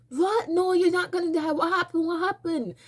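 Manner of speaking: worried wife. Retold or imitated. The wife urging Tamori she won't die, alarmed and high. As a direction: press her, alarmed and high. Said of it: "She's like".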